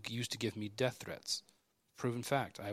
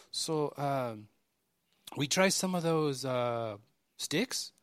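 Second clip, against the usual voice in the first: a light, higher imitation of a Mexican accent, rising high on "sticks"; a shy worker in a lightly imitated Mexican accent asking for a taste; 3 semitones higher, much slower than usual. Workers asking to taste the antelope sticks, lightly imitated Mexican accent. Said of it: "It was four Mexican dudes".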